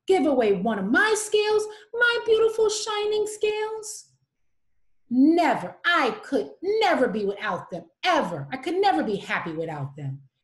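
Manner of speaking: loud, outraged and possessive; indignant refusal. Acted. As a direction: refuse loudly, clutching your treasure. Rainbow Fish refuses indignantly.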